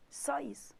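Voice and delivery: soft unvoiced "Só isso"; whisper. Real, not acted. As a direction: whisper it as a wink. Whispered aside.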